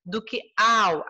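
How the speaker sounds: slow "que ao", vowels run together as one syllable; elision demo. Shown as a demonstration. Teacher showing the class an elision, vowels merged in "que ao". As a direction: join the vowels of "que ao" deliberately, drawn out. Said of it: "eu já tenho uma elisão".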